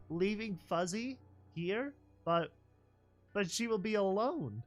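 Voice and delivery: voice cracking. Acted. Foal refusing to leave her pet, voice cracking. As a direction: let the voice crack on alone. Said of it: "Little voice crack".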